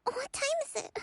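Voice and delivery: jolted out of sleep, surprised; startled awake. Acted. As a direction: snap awake in alarm.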